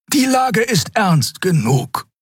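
slow, high-pitched reproach; drawn-out high scolding. A narrator's reading. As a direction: chide slowly in a high voice. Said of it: "tadelte Xea ihn".

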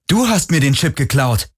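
high-pitched accusation; indignant high outcry; calling out. A narrator's reading. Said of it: "rief Galen".

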